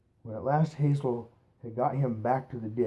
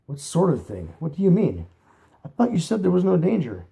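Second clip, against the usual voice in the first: higher, louder alarmed questions; 7 semitones higher, 7 dB louder than usual. A narrator's reading. Hazel to Fiver, higher alarmed questions. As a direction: press him, rattled and worried.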